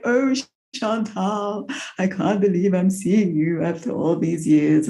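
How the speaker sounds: lightly voiced teary posh father; a hint of a weepy, high, posh dad; gently mimicking a tearful father; posh emotional father. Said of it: "He's like".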